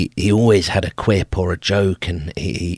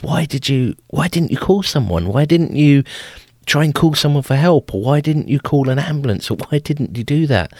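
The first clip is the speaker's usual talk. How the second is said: higher, urgent disbelieving questions; alarmed questions; 8 semitones higher than usual. Retold or imitated. Uncle questioning the grandparents, higher and alarmed. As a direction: press them on why nobody was called.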